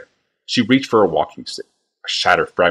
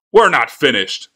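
louder, higher cut-off protest; angry protest; 7 semitones higher, 5 dB louder, slower than usual. A narrator's reading. Bly protesting to Tokasia, louder. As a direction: bark it, then get cut off.

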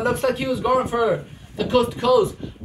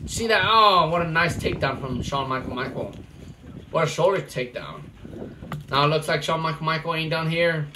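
loud, pumped-up wrestling commentary with yells at big moves; live play-by-play; 3 semitones higher, 3 dB louder than usual. Acted. Commentator calling a backyard wrestling match, hyped play-by-play. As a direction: call the match live for the crowd.

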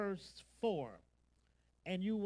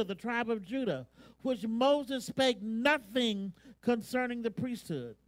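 loud, raised pulpit voice; forceful preaching; 4 semitones higher, 9 dB louder than usual. Real, not acted. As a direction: preach the scripture with insistent force.

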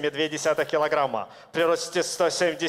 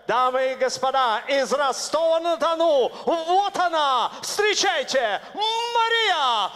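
rising to a high shouted name call; booming fighting game announcer; 13 semitones higher, 6 dB louder, slower than usual. Real, not acted. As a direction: stretch the fighter's name out slowly to the crowd. Ring announcer fighter intro.

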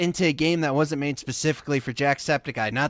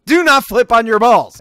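raised, higher-pitched order; loud warning; 5 semitones higher, 8 dB louder than usual. Real, not acted. Gamer ordering his rider mid-flip, loud warning. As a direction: call out an emphatic warning. Ordering the game character around.

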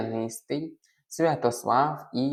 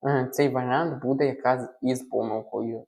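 ordinary delivery

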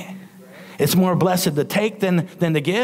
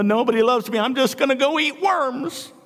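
put-on self-pitying child; 10 semitones higher than usual. Preacher as child complaining to mom, high self-pitying whine. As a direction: whine it high like a pouting kid. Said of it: "My mom used to say".

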